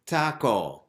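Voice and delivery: "taco" hit loud and drawn out; slow, forceful "taco" on the beat; emphasis on the beat. Shown as a demonstration. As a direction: punch out "taco". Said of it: "possible to do this".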